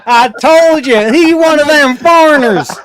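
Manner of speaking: put-on southern accent. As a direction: ham up a Southern accent, slowly.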